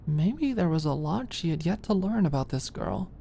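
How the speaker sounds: plain reading voice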